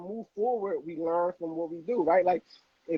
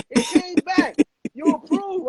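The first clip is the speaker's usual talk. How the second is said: second "It came back" high and louder, bursting out; excited; 11 semitones higher, 7 dB louder, slower than usual. Retold or imitated. Banker calling after him that the loan was approved, loud and high. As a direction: banker shouting the approval news. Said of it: "He said".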